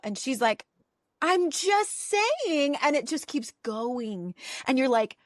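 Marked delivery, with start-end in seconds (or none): 1.2-2.8 high, drawn-out whiny self-defence; mock defensive protest. Imitating Meredith digging in at the dinner table, defensively. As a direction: stretch it out high, imitating a flustered excuse.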